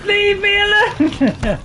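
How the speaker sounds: high, loud, drawn-out protest; losing it, yell slowly at your pursuer; panicking yell. Real, not acted. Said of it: "sorry, I'm losing it. I'm panicking".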